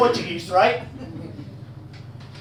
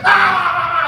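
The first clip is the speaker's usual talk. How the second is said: mock vampire lunge yell; 4 semitones higher than usual. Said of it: "He's like".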